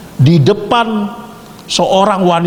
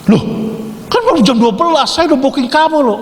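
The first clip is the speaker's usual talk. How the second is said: much higher, indignant man's voice; protesting customer; 11 semitones higher than usual. Retold or imitated. Man protests to the call girl, high and indignant. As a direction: whine it up high like a customer who paid.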